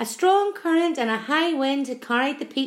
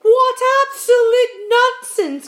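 high, loud, slow outburst; earthworm's drawn-out indignant protest; scornful cry; 14 semitones higher, 10 dB louder, slower than usual. A narrator's reading. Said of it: "cried the earthworm".

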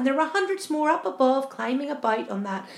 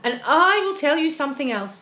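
higher, louder, pointed announcement; sharp retort; 4 semitones higher, 6 dB louder than usual. A narrator's reading. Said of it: "the centipede said sharply".

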